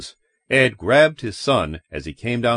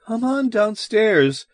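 put-on high, drawn-out, wavering call to the children; 11 semitones higher, slower than usual. A narrator's reading. Mother calling her children downstairs, wavering. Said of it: "Janet called in a wavering voice".

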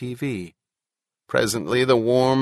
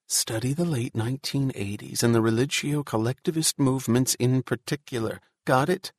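ordinary delivery, nothing marked

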